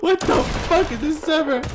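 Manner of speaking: high-pitched, giggly disbelief; incredulous exclaiming. Real, not acted. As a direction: ask it high, in baffled amusement. Laughing disbelief after the screaming.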